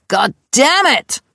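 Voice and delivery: slow, loud and high "God damn it!" with a falling end; angry outburst. Retold or imitated. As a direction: curse it out from the next room. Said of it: "da hörte ich".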